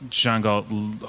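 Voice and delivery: ordinary talk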